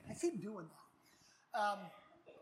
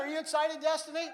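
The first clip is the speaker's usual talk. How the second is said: calling out; 4 semitones higher, 8 dB louder than usual. Real, not acted. Preacher calling out to congregation.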